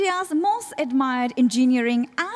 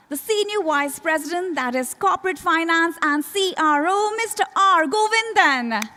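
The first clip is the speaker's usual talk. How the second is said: higher, projected build to the guest's name; rousing announcer introduction; 5 semitones higher, 4 dB louder than usual. Real, not acted. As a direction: call out the name for applause. Emcee introducing dignitary to applause.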